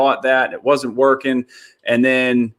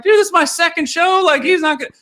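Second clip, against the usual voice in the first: high panicked squeal, much higher; freaking out; 3 dB louder than usual. Retold or imitated. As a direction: panicky voice climbing way up. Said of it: "I'm freaking out".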